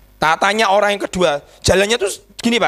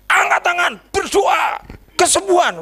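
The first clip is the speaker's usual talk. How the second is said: high, ringing healing command; prayer command; 10 semitones higher than usual. Retold or imitated. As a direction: call out orders to a praying crowd.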